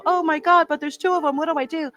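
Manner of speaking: high-pitched, mildly flustered self-mockery; mock panic. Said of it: "I'm getting thrown off".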